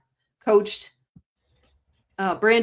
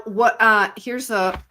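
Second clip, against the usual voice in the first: normal talking voice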